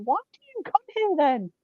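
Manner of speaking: high-pitched mock exasperation; exasperated retort. Shop worker answering a customer who moans every week, exasperated. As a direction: throw it back at them, pitched right up. Snapping back at complaining customer.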